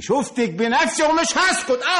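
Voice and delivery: high-pitched, heated warning; defiant threat. Acted. Brother warns sister he saw her himself, defiant and heated. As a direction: raise the pitch and refuse to back down.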